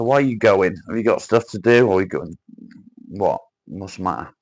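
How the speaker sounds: ordinary talk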